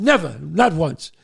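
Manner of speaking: loud, firm insistence; emphatic denial. Retold or imitated. Dad answering his son's doubt, emphatic denial. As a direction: shut the question down loudly.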